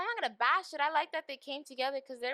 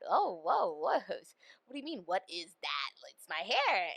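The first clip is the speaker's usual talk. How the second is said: mock offended. Acted offended reaction.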